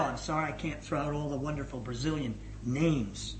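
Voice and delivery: normal talking voice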